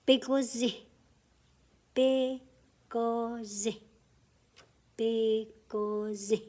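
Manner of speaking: "Bigozi" drawn out slowly; slow drill for the class. Shown as a demonstration. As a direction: stretch "Bigozi" out. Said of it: "The two together make it say".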